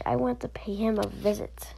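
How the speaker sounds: usual speaking voice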